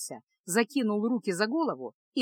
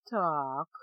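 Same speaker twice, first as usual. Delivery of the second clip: low, softer musing; thoughtful; 5 semitones lower, 4 dB quieter than usual. A narrator's reading. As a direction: say it slowly to yourself, weighing a problem. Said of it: "задумчиво проговорил Кайл".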